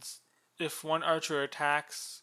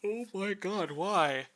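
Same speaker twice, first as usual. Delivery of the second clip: exasperated; 6 semitones higher, 5 dB louder, slower than usual. Real, not acted. Exasperated aside about hook shot.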